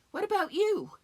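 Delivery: high, lifted question to listeners; direct challenge. Acted. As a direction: throw the question suddenly at the audience. Turns to challenge the audience.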